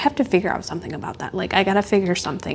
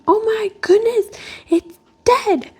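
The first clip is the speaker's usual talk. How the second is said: much higher, louder mock panic of a child; daughter's alarm; 13 semitones higher, 3 dB louder than usual. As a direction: imitate her daughter's shocked cry, pitched way up. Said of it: "my daughter going".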